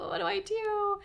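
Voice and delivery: very high, comic worried squeal; hammed-up helpless fretting; mock panic. Passenger fretting over an annoyed driver, mock panic. Retold worry about angry driver.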